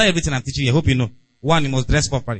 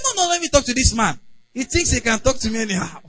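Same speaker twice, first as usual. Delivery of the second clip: mock angry driver; 7 semitones higher than usual. Retold quarrel with driver.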